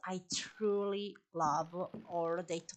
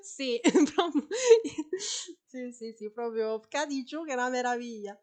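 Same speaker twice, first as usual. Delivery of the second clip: laughing; 3 semitones higher, 8 dB louder, faster than usual. Real, not acted. Laughing about the trap working.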